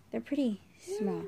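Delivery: usual speaking voice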